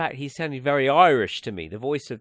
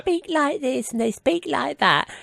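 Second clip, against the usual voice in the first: put-on high, louder kids' TV puppet voice; mock child's voice; 12 semitones higher, 6 dB louder than usual. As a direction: imitate a man faking a silly child's voice. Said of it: "It's like a guy doing a child's voice".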